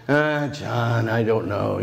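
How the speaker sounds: a hint of a reluctant younger colleague; hesitant Luke. Friend Luke voiced turning him down.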